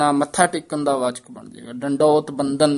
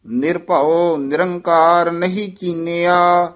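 measured chant with held line endings; scripture recitation; 3 semitones higher, 4 dB louder, slower than usual. Acted. Teacher models Gurbani for students, measured chanted recitation. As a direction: recite evenly, pausing between phrases.

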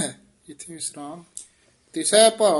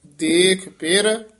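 ਦੇਖ ਪਿਰ slow and loud, rising on ਪਿਰ, then a full stop; stop after ਪਿਰ; 3 semitones higher, 5 dB louder, much slower than usual. Shown as a demonstration. Teacher marking the break after ਪਿਰ. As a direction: hold ਪਿਰ and break before ਸਾਧਾਰਿਆ. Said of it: "ਇੱਥੇ ਠਮਕ".

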